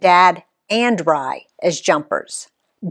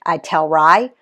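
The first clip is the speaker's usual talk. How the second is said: usual speaking voice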